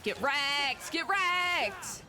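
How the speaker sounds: high taunting yell; real victory shout at the monsters; triumphant shout. Real, not acted. Taunting enemies in fight.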